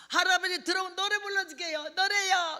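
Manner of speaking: high, loud enticing call; coaxing call. Retold or imitated. Preacher luring old men inside with songs, high coaxing call. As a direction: call out an offer, pitched high.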